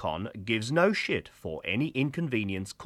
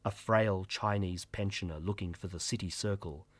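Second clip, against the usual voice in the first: ordinary talk, nothing marked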